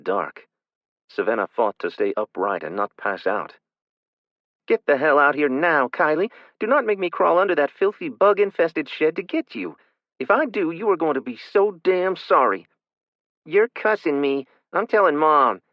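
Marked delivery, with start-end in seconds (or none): 4.7-12.7 angry shouting. Acted. 13.4-15.6 put-on whiny child. Child tattling.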